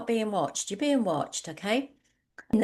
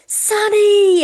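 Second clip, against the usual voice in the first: very high, much louder joyful shout of a name; excited shout; 13 semitones higher, 15 dB louder than usual. Retold or imitated. Stranger calling across the coffee shop, thrilled shout. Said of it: "Like, she went".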